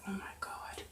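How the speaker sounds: whispered, nearly silent gasp of dismay; under her breath. Retold or imitated. Manager whispering her shock beside the cashier, under her breath. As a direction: breathe it out so the customer can't hear. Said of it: "she goes under her breath".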